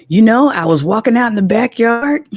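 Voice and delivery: a hint of dad's way of talking; imitating her father. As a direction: mimic your father telling his story. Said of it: "That's how he talked".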